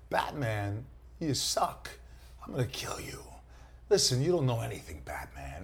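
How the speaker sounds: halting Walken cadence with odd pauses; Christopher Walken. Acted. Voicing Scarecrow to Batman as Walken, choppy halting menace. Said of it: "do your Christopher Walken for the Scarecrow character".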